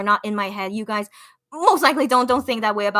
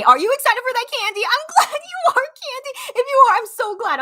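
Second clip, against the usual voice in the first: high, loud, giddy delight; excited squealing; 13 semitones higher, 5 dB louder than usual. Real, not acted. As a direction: squeal happily at a fan, pitched way up. Excitedly answers fan's comment.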